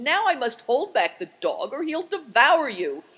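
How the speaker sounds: loud, high urgent warning; warning cry. A narrator's reading. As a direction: warn him at the top of the voice. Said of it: "cried Affliction".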